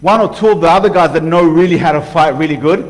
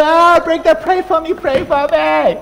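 high, pleading whimper of a coward; whiny scared believer; 7 semitones higher, slower than usual. Pretend. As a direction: beg helplessly like you're terrified. Mocking fearful weak believer.

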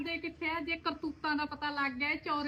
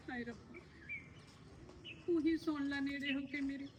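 sighing lament; 7 dB quieter than usual. Acted. Woman alone laments to God.

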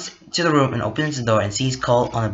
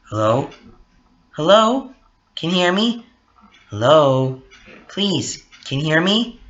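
higher, louder repeated hellos; pleading phone call; 4 semitones higher, 4 dB louder than usual. Acted. Cole calls again and gets no answer, pleading. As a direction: call down a dead line, asking to be heard.

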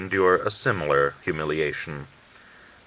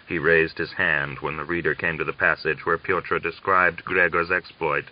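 ordinary delivery

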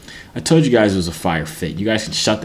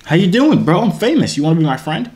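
excited fanboy; 8 semitones higher, 3 dB louder than usual. Acted. Greeting a famous streamer excitedly.

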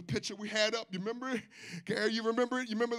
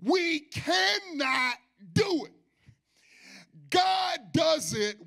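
full-force preaching; 3 semitones higher than usual. Acted. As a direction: preach it out, half singing.

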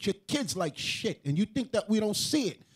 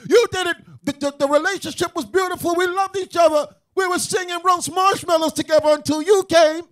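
higher, louder blaming rant; mock accusing shout; 6 semitones higher, 9 dB louder than usual. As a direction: point the finger and yell. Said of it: "They'll say".

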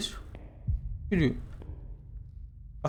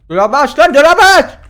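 rising panicked yell; panicked shout; 6 semitones higher, 9 dB louder than usual. Real, not acted. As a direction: scream it, growing frantic. Panicked yelling to open.

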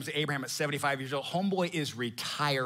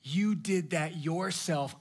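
slow reproach; slower than usual. Retold or imitated. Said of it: "And God goes".

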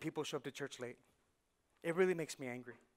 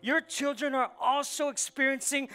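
louder and higher, line by line; full-force preaching; 6 semitones higher, 7 dB louder than usual. Acted. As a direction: preach it like a rally, climbing.

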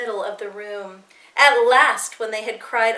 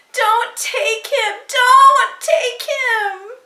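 high, loud, drawn-out wail, building; 13 semitones higher, 8 dB louder, much slower than usual. A narrator's reading. Little sister clinging to her brother's leg, long high wail. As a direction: stretch each word into a rising child's wail. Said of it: "Ruth wrapped herself around Joseph's leg and wailed".

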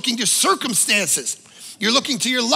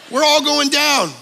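ordinary talk, nothing marked

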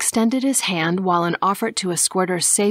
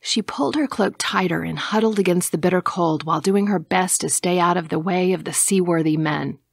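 ordinary delivery

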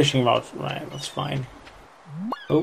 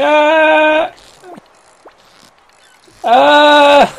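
high loud yelp; excited shriek; 14 semitones higher, 9 dB louder than usual. Real, not acted. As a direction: squeal with excitement. Excited game moment, shrieks.

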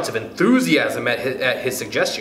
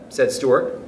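usual speaking voice

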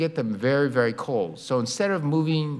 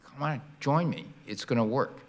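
conspiratorial hush; 6 dB quieter than usual. Retold or imitated. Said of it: "so I said".

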